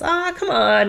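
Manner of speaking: high-pitched, playful pleading; retold coaxing. Boss urges her to take the job, coaxing. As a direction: imitate a boss coaxing someone round.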